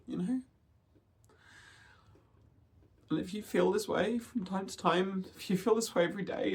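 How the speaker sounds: talking through tears, fighting to stay steady; tearful. Real, not acted. Vlogger telling viewers about a hard dysphoria day, tearful. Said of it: "I'm gonna try not to cry too much in this video".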